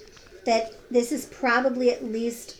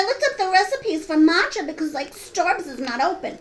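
higher, affected start, slowing on the slang; mock slang-speak; 4 semitones higher than usual. Co-host parodying her friend's matcha remark, mock cutesy. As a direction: mimic a cutesy slang talker. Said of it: "you have to talk like that".

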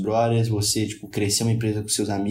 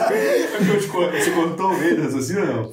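pergunta rindo, sem graça; 4 semitones higher than usual. Real, not acted. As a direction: fala entre risos. Ri ao perguntar se interrompeu. (rindo enquanto pergunta)

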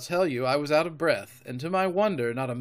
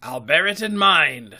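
louder pirate captain with ambiguous tone; curious sea-dog accent, half mocking; 4 semitones higher, 9 dB louder than usual. A narrator's reading. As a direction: answer in an odd pirate accent. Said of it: "with an accent so curious".

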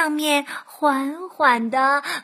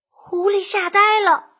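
plain reading voice

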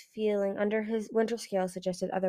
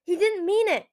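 high, louder, urgent protest; yelled plea; 11 semitones higher, 5 dB louder than usual. A narrator's reading. Winter pulls talons off his brother, yelling. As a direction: yell it in defence. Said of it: "Winter yelled".

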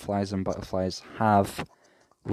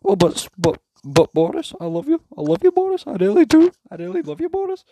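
much higher and louder, squeaky hammed-up begging; 8 dB louder than usual. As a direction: whine it like a desperate fanboy. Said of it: "[name] just turns around and goes".

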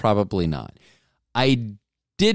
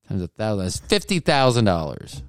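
ordinary talk, nothing marked

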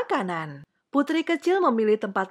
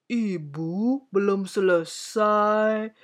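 slow, sleepy queen; yawning; much slower than usual. A narrator's reading. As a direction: drag the words through a yawn. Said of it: "jawab Ratu sambil menguap".

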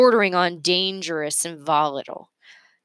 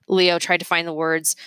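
ordinary delivery, nothing marked

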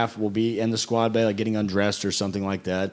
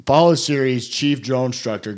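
ordinary delivery

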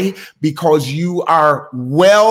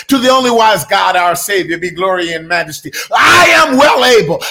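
loud, high fervent sermon declaration; preaching at full force; 4 semitones higher, 4 dB louder than usual. Acted.

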